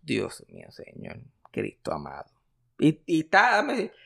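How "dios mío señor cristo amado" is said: low, soft despairing mutter; exasperated groan. Real, not acted. Reacting to a trailer with a friend, low despairing groan. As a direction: groan to heaven at the awful trailer.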